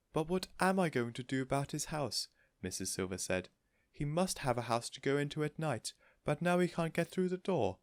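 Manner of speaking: a light worry; worried Mrs Silver. A narrator's reading.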